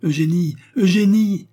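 high, loud calls, rising in urgency; crying out. A narrator's reading. Léon searches the apartment for Eugénie, desperate loud cries. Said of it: "cria-t-il en faisant le tour".

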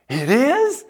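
sudden high, loud "It is?"; surprised. Retold or imitated. Girl caught off guard by pastor's remark, surprised and thrilled.

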